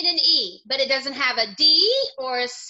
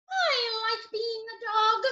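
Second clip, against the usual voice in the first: ordinary talk, nothing marked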